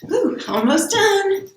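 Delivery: high breathless puff; winded from jumping jacks; out of breath. Real, not acted.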